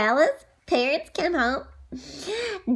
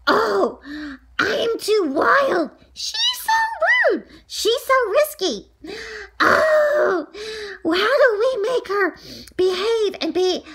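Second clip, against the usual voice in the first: exasperated parents; 5 semitones higher than usual. Retold or imitated. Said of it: "my parents said".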